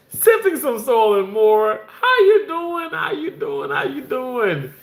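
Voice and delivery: very high, delighted hello; excited greeting. Real, not acted. Host welcoming a viewer to the chat, excited. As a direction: squeal the greeting, stretching the words.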